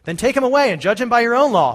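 an irritated governor brushing them off; fed-up Roman governor, loud and high; exasperated Pilate. Acted. Pilate pushing Jesus back.

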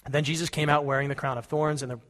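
usual speaking voice

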